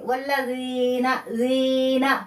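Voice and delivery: slow, chanted वल्लज़ीना with the ee drawn out; recited with madd. Shown as a demonstration. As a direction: intone ज़ीना, holding the ee. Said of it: "लगा के अदायगी करेंगे". (तिलावत की तरह ज़ीना खींच कर)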